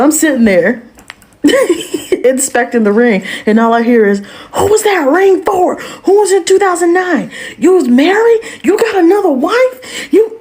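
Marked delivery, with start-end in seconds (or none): 4.5-10.4 much higher, crying accusations; imitating a furious wife. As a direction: wail at the cheating husband, retold. Wife yelling at husband, retold.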